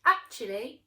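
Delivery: loud, rising to high pedantic voice; high loud correction; know-it-all tomato. Acted. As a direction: correct them proudly. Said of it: "said Tomato".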